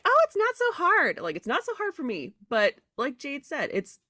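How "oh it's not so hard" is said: high, slow, airy mock voice; mock breezy dismissal. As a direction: toss it off like it's nothing, much higher. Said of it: "it doesn't help for me to be like".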